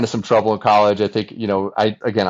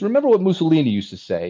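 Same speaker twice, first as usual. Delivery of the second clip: put-on light Italian accent, pitched higher; quoting his Italian father with a hint of Italian accent; imitating dad's Italian accent; 9 semitones higher than usual. Italian father advising his son, mild imitated Italian accent. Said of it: "in his thick Italian accent".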